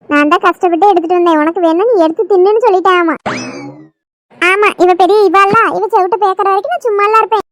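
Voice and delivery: loud, heated sibling squabble; sisters quarrelling. Acted. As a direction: shout and taunt your little sister. Two sisters quarrel loudly. (தங்கையுடன் சண்டையிடும் உரத்த கோபக் குரல்)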